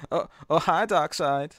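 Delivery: lightly voiced halting Wiseau greeting; a hint of Wiseau's odd, drawn-out hi; full Tommy Wiseau. Doing Jason for the co-hosts as full Tommy Wiseau. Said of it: "I'll just go full Tommy Wiseau".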